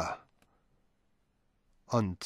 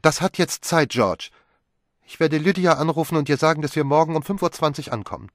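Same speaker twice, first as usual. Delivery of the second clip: put-on higher, louder, fast young wife; Magdalene brisk; 8 semitones higher, 7 dB louder than usual. A narrator's reading. Wife cuts George off and settles the trip, brisk. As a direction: brush him off and decide.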